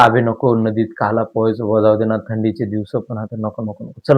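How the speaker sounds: low, soft, backing-away voice; a low, hushed, unwilling friend; reluctant friend. Acted. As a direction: mumble your refusal, wanting out. Reluctant friend refuses to swim.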